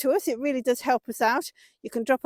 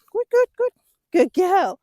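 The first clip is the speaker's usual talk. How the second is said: excited praise; 7 semitones higher, 6 dB louder than usual. Real, not acted. Horse finally gets the weave.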